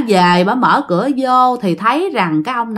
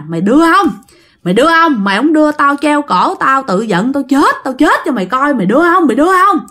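threatening shout; 4 semitones higher than usual. Acted. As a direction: bellow the demand again and again. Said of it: "ổng vừa đánh vừa nói nè".

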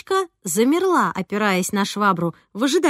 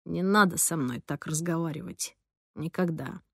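much softer, low and barely heard; very quietly; 4 semitones lower, 8 dB quieter than usual. A narrator's reading. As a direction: say it almost under breath, dead serious. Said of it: "тихо, очень тихо, еле слышно сказал". (еле слышно)

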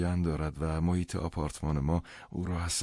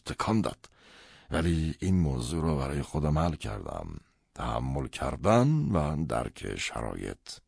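troubled father; 4 dB louder than usual. Acted. Father confides Leo's conversion shook him, troubled and emphatic.

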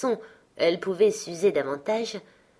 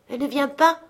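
dismayed surprise; 7 semitones higher, 5 dB louder than usual. A narrator's reading. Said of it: "frappé d'un abattement soudain".

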